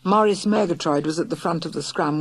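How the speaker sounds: ordinary delivery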